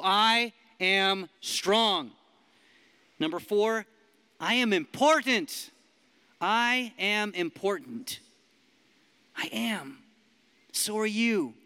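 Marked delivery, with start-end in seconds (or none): 0.0-2.1 loud declaration. Shown as a demonstration. Preacher leads the room in declaring, slow and loud. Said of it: "say 'em like you believe them". 4.4-5.7 loud declaration. Shown as a demonstration. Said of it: "say 'em like you believe them". 9.3-10.0 breathy whispered affirmation. Real, not acted. Preacher whispers the affirmation to himself. Quiet aside after declaration.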